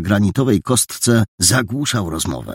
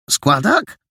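higher, rising disbelieving echo; surprised question; 8 semitones higher than usual. Acted. Boy repeats the painter's word, higher and rising in surprise. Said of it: "zdziwił się Paragon".

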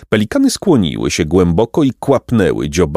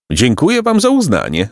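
lightly voiced self-important bird, higher; gracious pelican with a lifted voice; pompous pelican; 8 semitones higher than usual. A narrator's reading. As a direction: accept applause grandly. Story figure, pelican.

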